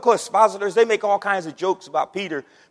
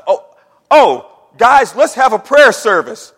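louder, slow, hammed-up pious offer; mock pious suggestion; 13 dB louder, slower than usual. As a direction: play the goody-goody nobody listens to. Mocking churchy buddy among friends.

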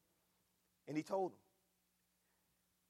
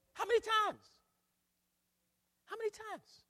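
preaching cry; 5 dB louder than usual. Acted. Preaching climax.

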